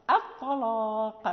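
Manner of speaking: slow, low "الطلاق" with a heavy lam; thickened lam, Warsh reading. Shown as a demonstration. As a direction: thicken the lam of "الطلاق", hamza still thin. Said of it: "إذا غلظنا اللام".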